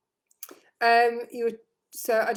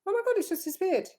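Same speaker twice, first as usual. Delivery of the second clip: high, fast startled cry; surprised; 11 semitones higher, faster than usual. Real, not acted. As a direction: caught off guard, voice jumps up. Text vanishes mid-reading.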